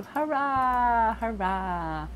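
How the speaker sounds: a little playful cheer, first one high; mock cheer. Cheering on viewers for their love news, playful.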